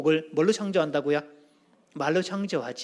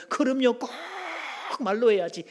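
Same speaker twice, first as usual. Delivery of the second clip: higher-pitched, hammed-up agreement; role-play reply; 7 semitones higher than usual. Shown as a demonstration. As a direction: answer with exaggerated conviction, stretching the key word. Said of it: "자, 역할극 해보기".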